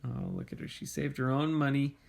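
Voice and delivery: ordinary delivery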